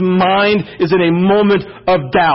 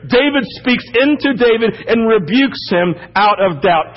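ordinary delivery

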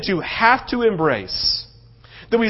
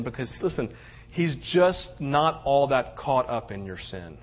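much softer, low intimate sermon voice; quiet preaching; 9 semitones lower, 10 dB quieter than usual. Acted. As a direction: draw the congregation close, hushed.

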